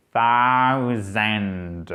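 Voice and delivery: THOUSAND très étiré, D final bien détaché; version longue décomposée. Shown as a demonstration. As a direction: allonge chaque son de thousand jusqu'au D. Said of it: "Je vous le fais court". (thousand au ralenti, voix grave)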